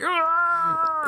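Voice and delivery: imitating a howl of grief, very high and loud; mock anguished howl. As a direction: ham up the villain's wail to the sky. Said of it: "and he's like".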